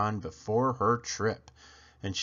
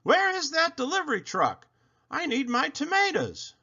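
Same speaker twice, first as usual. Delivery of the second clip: much higher, impatient call; yelled. A narrator's reading. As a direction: demand it across the street. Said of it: "stomped out onto the sidewalk and yelled".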